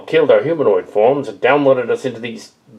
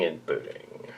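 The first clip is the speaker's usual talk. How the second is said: whispered fade-out; 7 semitones lower, 17 dB quieter, slower than usual. Acted. Machine voice fades out.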